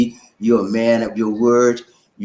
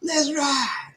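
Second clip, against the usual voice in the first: high hype call, trailing off softer; 14 semitones higher, 4 dB quieter, much slower than usual. Real, not acted. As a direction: send them off riding high. Hype shout-out.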